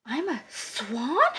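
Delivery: slow, soft disbelief rising on swan; astonished question. A narrator's reading. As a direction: ask it in slow, dawning wonder. Duckling learns he is a swan.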